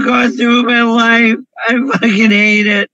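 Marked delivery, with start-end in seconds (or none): none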